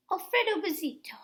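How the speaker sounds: ordinary delivery, nothing marked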